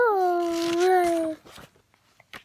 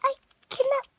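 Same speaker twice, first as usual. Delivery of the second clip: high, whiny upset cry; alarmed crying voice; 6 semitones higher, much slower than usual. Acted. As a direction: wail it out in distress. Child reading Gerald's alarm.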